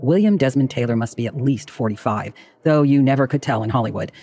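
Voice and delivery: plain reading voice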